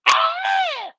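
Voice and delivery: very high, loud retold scream; mock scream. Man re-enacts the fight, mock scream. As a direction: let out a sudden imitated shriek. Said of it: "The shoe wanna scream".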